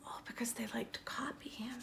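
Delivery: soft unvoiced aside; whisper. Real, not acted. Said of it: "aside to kids".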